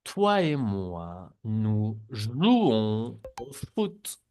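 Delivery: slow, careful, nous jouons au foot drawn out; slow model sentence. Shown as a demonstration. Teacher reads slowly for students to repeat. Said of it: "repiten las frases también".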